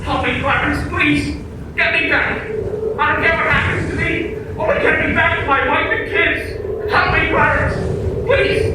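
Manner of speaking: man begging his guardian angel to let him live again; desperate pleading shout. Acted. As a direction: beg for your life back. Desperate prayer to return.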